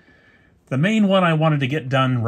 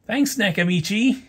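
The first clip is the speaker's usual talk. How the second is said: sarcastic; 10 semitones higher than usual. Real, not acted. Sarcastic thanks to the maker.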